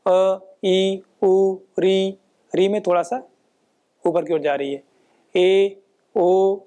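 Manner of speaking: vowels "अ" "ई" "उ" "री" "ए" "ओ" "ऐ" "औ" sounded in pairs, held clearly; vowel demo. Shown as a demonstration. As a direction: pair the vowels "अ, ई", "उ, री" and sound each one out. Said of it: "जिह्वा की आप स्थिति को यदि आप अनुभव". (स्वरों को जोड़ों में साफ़ बोलना)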